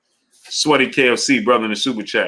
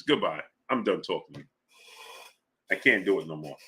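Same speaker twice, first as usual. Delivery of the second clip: much lower and softer, giving up; resigned; 7 semitones lower, 11 dB quieter than usual. Real, not acted. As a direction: drop it, defeated. Gives up on argument.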